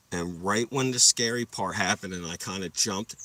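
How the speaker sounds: ordinary talk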